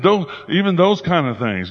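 ordinary delivery

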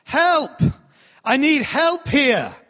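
a trainee's first go at yelling for help; practice yell for help. Shown as a demonstration. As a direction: demo a first, tentative cry for help. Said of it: "they had to all practice yelling".

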